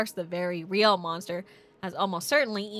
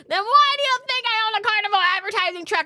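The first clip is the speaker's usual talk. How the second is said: much higher, louder and faster, a shrill-free exasperated yell; irritated shout; 10 semitones higher, 6 dB louder than usual. Acted. Clerk scolding the detective for asking about the truck, exasperated shout. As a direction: blast him with indignation.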